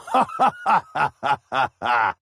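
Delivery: gloating laugh at the hunt; mocking killer's laugh; villain cackle. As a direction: cackle like you've found your prey. Villain cackles at hidden prey.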